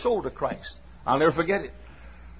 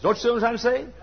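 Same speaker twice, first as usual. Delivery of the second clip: usual speaking voice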